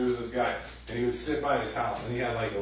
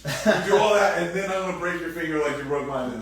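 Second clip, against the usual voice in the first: mock vengeful outburst; 5 semitones higher, 8 dB louder than usual. Vengeful partner acted out.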